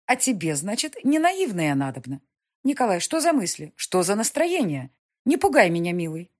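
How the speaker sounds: властная графиня, громче и выше; мать поучает сына, с напором; напористая мать. Acted. Mother voiced. (властная мать, повышенным тоном)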